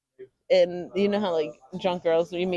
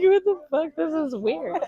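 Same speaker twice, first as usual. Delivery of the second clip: shocked; 8 semitones higher than usual. Retold or imitated. Retold shock at date kissing.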